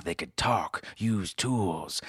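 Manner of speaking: usual speaking voice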